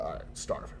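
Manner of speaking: breathy whisper to himself; whispered mutter. Retold or imitated. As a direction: mutter it under your breath.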